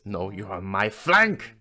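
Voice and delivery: loud, slow insistence; stubborn, emphatic retort; acted bickering. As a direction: drag it out, rising on FLANK. Acted quarrel over flank.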